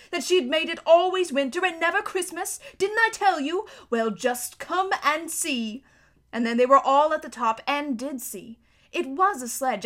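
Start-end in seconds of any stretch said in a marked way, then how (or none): 0.1-5.8 triumphant. A narrator's reading. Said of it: "answered Mister Beaver".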